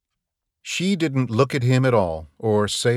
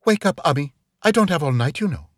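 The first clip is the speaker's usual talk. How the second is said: impatient, higher voice hurrying him; 6 semitones higher, faster than usual. Acted. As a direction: wake him up with brisk impatience. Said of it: "and then the impatient voice".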